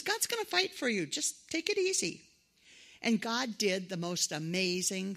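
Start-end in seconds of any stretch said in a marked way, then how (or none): 0.0-2.2 higher, encouraging Moses voice; Moses reassuring. Retold or imitated. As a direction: lift the voice to cheer the people on. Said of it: "So Moses told him, he says".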